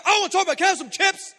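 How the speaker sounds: very loud, high yelled refusal; explode, and stay up for the request; acted exasperated outburst. Provoked man fending off his friend, exasperated yelling.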